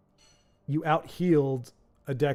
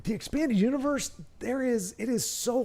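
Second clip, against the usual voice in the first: excited; 8 semitones higher than usual. Real, not acted. Excited rant on expanded universe.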